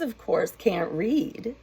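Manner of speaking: ordinary delivery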